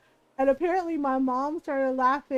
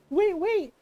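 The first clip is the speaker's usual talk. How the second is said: scared little brother; 6 semitones higher than usual. Retold or imitated.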